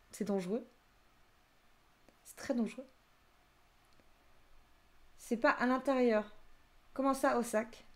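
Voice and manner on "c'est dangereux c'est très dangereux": murmure sans voix, comme un secret; chuchoté. Acted. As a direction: souffle l'avertissement tout bas. Chuchote un avertissement. (chuchotement d'alerte)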